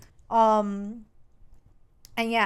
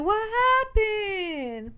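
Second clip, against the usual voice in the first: high, very drawn-out question; hammed-up concern; 10 semitones higher, much slower than usual. Man asking upset girlfriend what happened, hammed-up concern. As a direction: stretch it out high in mock concern.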